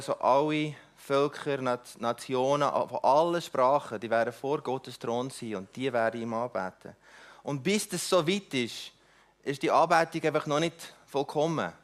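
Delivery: ordinary talk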